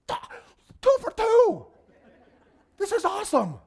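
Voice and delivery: very high, loud whoop of triumph; hammed-up excited yelling; elated shout. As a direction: cheer it like a winning score. Retold elation at second confirmation.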